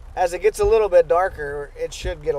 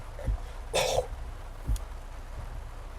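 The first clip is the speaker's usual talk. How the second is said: hacking cough; coughing fit; 11 dB quieter than usual. Real, not acted. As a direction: cough out the bug. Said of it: "I literally swallowed a bug".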